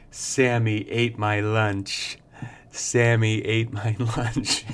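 slow, lightly voiced smirk of a little boy; a father lightly imitating his son's slow, smirking report; smirking toddler. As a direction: drag it out slowly with a sly grin, a laugh slipping in. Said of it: "He kept saying with a smirk".

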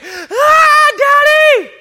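put-on high, loud crying child shouting for Daddy; scared small child. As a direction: wail like a frightened kid woken by thunder. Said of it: "my six-year-old and my three-year-old".

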